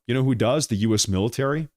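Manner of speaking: normal talking voice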